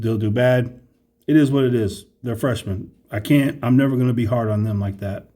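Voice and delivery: ordinary talk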